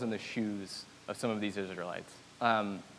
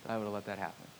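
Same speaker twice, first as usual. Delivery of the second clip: gentle rebuke; 2 semitones lower, 10 dB quieter, much faster than usual. Retold or imitated. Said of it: "turns to them and said".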